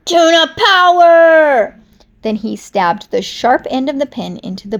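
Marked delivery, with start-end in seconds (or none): 0.1-1.8 high, loud and slow, a drawn-out war cry; battle yell. Acted. Piranha stabs the pen, yelling a slow high battle cry. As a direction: bellow it like a superhero charge.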